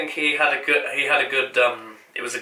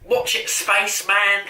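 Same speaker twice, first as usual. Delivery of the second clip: slow, mock-bossy Donna snap; imitating Donna; 5 semitones higher, slower than usual. Imitating Donna telling off the Doctor, slow and sharp. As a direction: scold him the way Donna would. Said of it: "because she's like".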